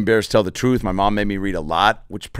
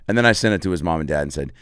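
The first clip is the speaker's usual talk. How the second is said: usual speaking voice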